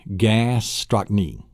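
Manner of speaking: "gastrochny" slowly split as "gas-troc-nee"; syllable breakdown. Shown as a demonstration. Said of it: "break the word down into its syllables".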